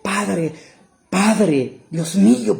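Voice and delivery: acted anguished plea; calling the Father. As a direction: call out to a father who has left. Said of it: "él está diciendo al Padre".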